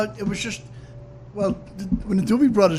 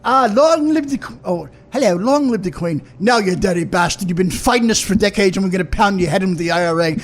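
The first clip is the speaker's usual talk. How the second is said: a mild put-on English accent trading barbs with a brogue; English vs Irish brogue. Put-on accents for a host's request, lightly done. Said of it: "Do your English guy talking to the Irish guy".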